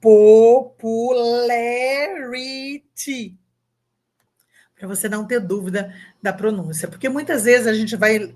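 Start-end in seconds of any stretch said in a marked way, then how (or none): 0.0-3.4 "Popularity" stretched out, "po-pu-le-ri-ti", and louder; very slow English demo. Shown as a demonstration. Teacher shows the GPT pronunciation guide, word drawn out. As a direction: read "Popularity" syllable by syllable, as the phonetic guide spells it. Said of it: "Como se você estivesse lendo em português".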